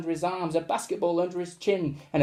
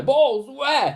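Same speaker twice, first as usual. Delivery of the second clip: high, loud demand; 9 semitones higher, 7 dB louder than usual. Acted. Teacher demanding where the balls are, high and loud. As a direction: demand an answer at once. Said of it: "he demanded".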